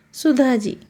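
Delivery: ordinary delivery, nothing marked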